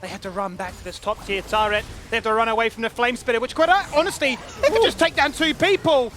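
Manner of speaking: hyped shouting play-by-play; excited. Real, not acted. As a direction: caster yelling over a big play. Big play erupts, caster hypes.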